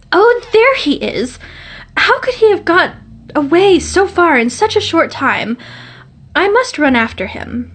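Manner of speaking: high, eager cry of discovery; excited exclamation. A narrator's reading. Girl sees the visitor walking away, excited high exclamation. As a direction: spot him and call it out, slowed and high.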